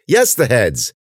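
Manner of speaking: "Yes, the heads!" high, then falling; exasperated retort. Acted. Ben retorts to Cece, "Yes, the heads!" exasperated. Sarcastic retort to obvious question.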